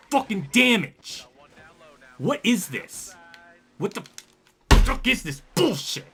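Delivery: high, loud, drawn-out rage; furious screaming. Real, not acted. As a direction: scream at the game in exasperated disbelief.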